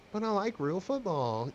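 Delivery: whiny complaining tone. Pretend. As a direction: whine it like a spoiled fan. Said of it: "I'm gonna do my whiny voice".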